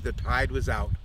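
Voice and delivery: ordinary delivery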